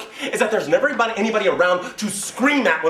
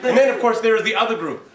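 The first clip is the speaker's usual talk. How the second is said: ordinary talk, nothing marked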